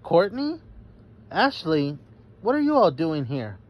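high, rising surprised calls of each name; surprised cry. A narrator's reading. Jania spotting her friends in the woods, surprised. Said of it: "Jania cried".

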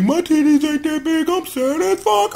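high-pitched mock whining; hammed-up jealous complaint, way up high; jealous mama. Recapper voicing jealous mamas watching [name], mock whine. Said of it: "Mama's got jealous".

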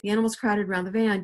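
ordinary delivery, nothing marked

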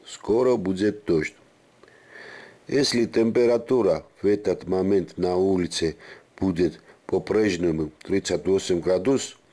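ordinary talk, nothing marked

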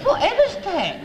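high-pitched startled voice; startled cry. Retold or imitated. A man who had nothing to do with it, crying out high. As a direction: jump up high in mock alarm. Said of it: "cried a man".

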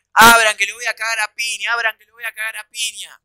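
re-enacted outraged demand; angry threat. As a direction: shout threats at a shut door, repeating fast. Threatens while pounding on the door.

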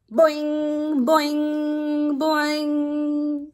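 high, held, ringing boings; bouncy kangaroo. Acted. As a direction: let each spring ring out high and long. Acting out a kangaroo's hop.

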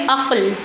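अक़ल as "aql", one syllable; no vowel between क़ and ल in अक़ल; correct joined reading. Shown as a demonstration. Teacher asks what it became, answers अक़ल joined as "aql". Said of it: "क्या बन गया ये?".

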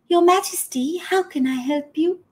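lightly voiced elegant old lady; graceful old woman. Old dancer greets the king, lightly graceful. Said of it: "an old woman, but still very graceful".

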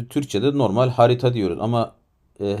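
ordinary talk

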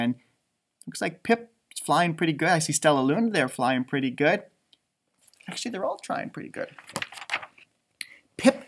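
plain reading voice

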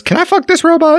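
squeaky high-pitched question; high mock voice. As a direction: ask it in a silly high voice. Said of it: "the whole point ... was".

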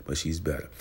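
ordinary talk

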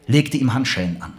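plain reading voice

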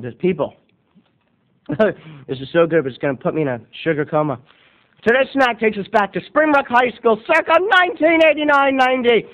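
normal talking voice

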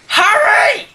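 high, loud shout of his name; angry yell. Real, not acted. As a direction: bellow his name across the room. Yells at Harry in game.

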